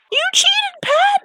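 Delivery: high indignant outburst at a cheater; outraged shout. Real, not acted. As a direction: yell the accusation in outrage. Ranting at villain Pat.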